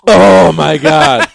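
sudden loud slow ecstatic groan; goes crazy. Acted. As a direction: lose it over a sip of water. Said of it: "he goes crazy".

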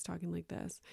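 very soft, dropped-away voice; trailed-down mumble. Shown as a demonstration. Imitating husband on podcast, barely audible mumble. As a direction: let it sink until barely heard. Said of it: "he goes down like this".

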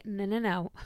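ordinary talk, nothing marked

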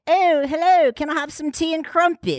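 high-pitched cartoon British accent. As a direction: put on a fussy, high British voice. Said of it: "think everything is just like".

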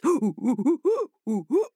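high monkey hoots; monkey hooting. Acted. Monkey drops from the trees, hooting. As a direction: hoot like a monkey.

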